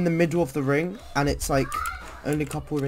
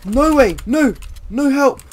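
panicked high shriek; shrieking jackpot winner; 11 semitones higher, 9 dB louder than usual. Real, not acted. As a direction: shriek in real alarm, loud. Enemies appear during tense match.

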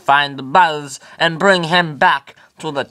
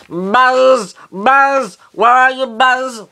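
high, loud shouts of a name; calling out; 12 semitones higher, 5 dB louder, much slower than usual. Acted. Woody searching the room for Buzz, shouting. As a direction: yell across the room for a lost friend.

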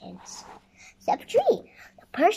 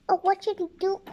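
high-pitched cry of mild dismay; worried cry; 10 semitones higher, 3 dB louder, much faster than usual. A narrator's reading. Queen at a loss for a princess, mildly dismayed cry. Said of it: "cried the queen".

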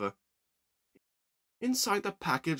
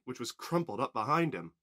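plain reading voice

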